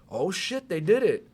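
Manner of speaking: high-pitched retold astonishment; acted-out wide-eyed amazement; amazed realization. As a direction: relive each discovery with rising pitch. Reliving amazed realization while watching.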